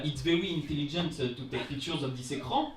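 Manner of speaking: put-on English with a heavy French accent; mock-proud English in a clumsy French accent; thick French accent. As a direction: speak English as if reading French. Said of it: "avec, euh, un accent des plus parfaits".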